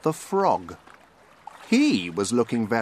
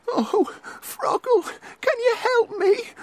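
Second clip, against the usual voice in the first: very high duck voice, pleading; squeaky high duck asking for help; 3 dB louder than usual. A narrator's reading.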